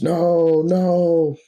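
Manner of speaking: a horrified scream of denial. Acted. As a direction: Bruce seeing the fly, screaming. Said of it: "he screams".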